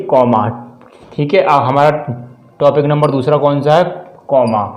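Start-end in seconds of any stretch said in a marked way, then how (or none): none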